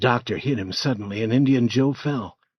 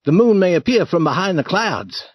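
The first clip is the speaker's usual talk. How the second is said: louder, pressing order; urgent doctor; 6 semitones higher, 6 dB louder than usual. Acted. Doctor urging the grave robbers, louder urgent order. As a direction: hurry the men along. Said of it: "he said".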